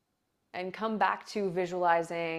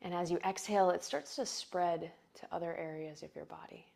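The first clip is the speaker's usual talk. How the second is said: guided meditation; 4 dB quieter than usual. Acted. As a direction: breathe the words out softly.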